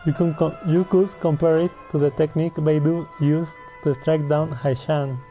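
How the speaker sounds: ordinary delivery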